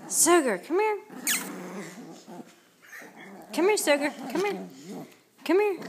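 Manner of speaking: high sing-song call to the pet. Real, not acted. Owner calling her pet sugar, high sing-song coax.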